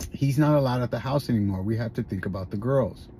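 low, soft, quickly spoken quote; retold hushed verdict. Pops telling my mom he's not allowed, retold in a low hush. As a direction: imitate a father quietly laying it down.

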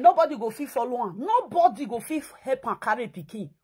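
ordinary talk

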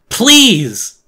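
high, screamed begging; desperate plea. Acted. Boy begging his wounded enemy about Penny, desperate scream. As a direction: cry it out at full volume, pleading.

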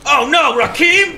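high, over-dramatic cry of dismay; mock dismay. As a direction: wail the name in pretend horror. Mock dismay at unknown character's fate.

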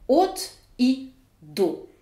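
"от" and "до" each pressed with stress; stressed prepositions. Shown as a demonstration. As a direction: lean on "от" and "до". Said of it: "besoin d'insister sur la préposition". (accent appuyé sur "от" et "до")

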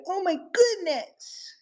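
very high, slow parrot drawl; high drawn-out parrot impression; imitating the parrot. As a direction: stretch it out high like the bird. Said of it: "He was like".

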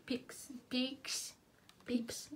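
"peeps, peeps" whispered with no voice. Shown as a demonstration. Showing viewers how she says peeps, in a whisper. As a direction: breathe out "peeps" in a hush. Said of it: "what I call peeps".

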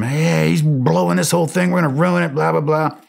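high-pitched, exasperated rant; angry griping. Retold or imitated. Soldier griping to his guys about the boss, angry. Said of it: "I probably cussed him".

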